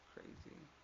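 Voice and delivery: "Crazy" whispered with no voice at all. Retold or imitated. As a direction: breathe "Crazy" out as a bare whisper. Second take whispered.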